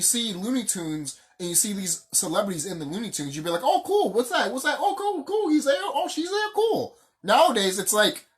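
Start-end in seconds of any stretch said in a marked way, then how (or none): 3.6-6.9 high-pitched, mock-delighted fan chatter; imitated excitement. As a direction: play a thrilled viewer spotting celebrities. Acting out past delighted viewer reaction.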